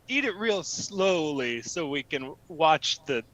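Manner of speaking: low, lingering mock-seductive voice; creepy coaxing. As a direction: coax them in a low creepy tone. Creepy coaxing in improv scene.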